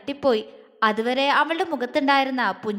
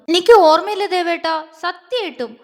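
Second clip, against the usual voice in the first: higher, louder pouty protest; pouting; 5 semitones higher, 7 dB louder than usual. A narrator's reading. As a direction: whine it like a sulking girl. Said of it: "അവള് ചുണ്ടു പിളർത്തി". (ചുണ്ട് പിളർത്തി പരിഭവത്തോടെ)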